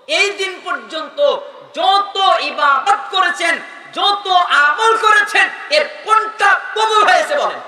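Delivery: high, slow, hammered-out demand; shouted preaching challenge. Acted. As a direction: stretch and punch each key word at full force. Preacher voices a challenge, full force.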